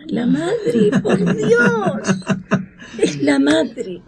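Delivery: high, laughing amazement; laughing joy. Real, not acted. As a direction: laugh through the words in delight. Grandmother sees granddaughter resemble mother. (asombro entre risas)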